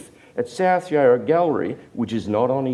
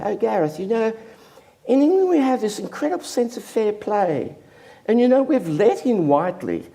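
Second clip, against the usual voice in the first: higher, refined English gallery-owner voice; very cultured voice; 10 semitones higher than usual. Retold or imitated. Dealer turning down a young Australian, in cultured voice. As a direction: put on his plummy, cultured tone. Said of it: "and very cultured voice".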